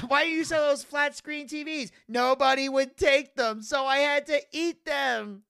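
high, overwrought mock complaint; hammed-up protest. Skit characters on flat screen TVs, hammed-up protest. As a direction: protest it high, dragging out the answer. Acted argument in retold cartoon skit.

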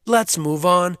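loud, upbeat hype for the fans; excited. Real, not acted. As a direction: pump up the listeners. Hyping up before the music.

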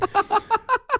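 very high, stuttering cackle; imitated manic laugh. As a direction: cackle senselessly like someone out of it. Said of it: "and then laughing".

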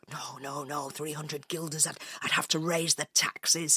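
soft, low inner voice; mayor's anxious thought. A narrator's reading. Mayor worrying over three hundred guilders, thinking quietly. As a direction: fret privately under the breath. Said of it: "In his head he thought".

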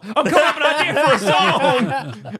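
high, loud laughing yell; Dewey shouting back mid-argument, laughing; mock shout. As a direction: yell it back in a hammed-up fight. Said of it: "they're having a fight".

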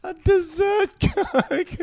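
slow, high, drawn-out scoffing; mock incredulous. Real, not acted. Mocking the co-host's dessert Coke idea, slow high scoff. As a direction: stretch it out in disbelief. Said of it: "Is that a thing?".

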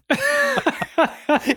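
squealing laugh; high burst of laughter. Real, not acted. Laughing at shared joke.

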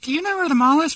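high-pitched imitation of a companion; Megan. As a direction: ask in a put-on high voice. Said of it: "Megan's like".

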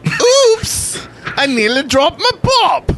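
high, hammed-up fake English accent; a terrible mock English accent; bad English accent. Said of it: "that was a horrible English accent".

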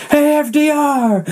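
high, drawn-out mock holler; calling out. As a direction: call up to someone far away, stretching each word. Calling to someone flying past.

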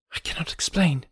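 hushed, urgent whisper; low eager voice. A narrator's reading. Woman warns a stranger secretly, low eager whisper. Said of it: "She spoke in a low, eager voice".

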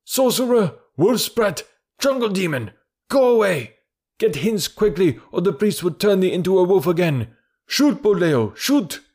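high, urgent angry-mob shouts; high-pitched shouted jeers and commands; villagers shouting. A narrator's reading. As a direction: hurl accusations like a hostile crowd. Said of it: "and the villagers shouted".

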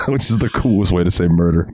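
normal talking voice

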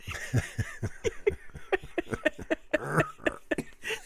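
long airy laugh; breathy laugh. Real, not acted. After finishing his story.

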